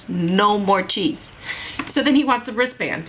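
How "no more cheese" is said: NO MORE CHEESE drawn out slowly, word by word; slow, emphatic "No more cheese" as a final ruling; firm final repeat. Retold or imitated. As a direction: lay down the law, stretching "No more cheese". Laying down the law to staff.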